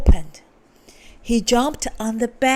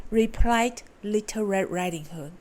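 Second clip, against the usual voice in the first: ordinary delivery, nothing marked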